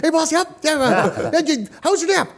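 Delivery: high-pitched eager lackey; chirpy overcaffeinated intern voice; high eager assistant. Acted. Playing the cameraman Gregory.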